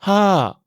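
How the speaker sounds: "Ha" starting high, then descending; falling tone. Shown as a demonstration. Teacher modelling Thai five, high-to-falling "Ha". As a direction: breathe the H and slide "Ha" down from high. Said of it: "le ton de la voix part des aigus et descend".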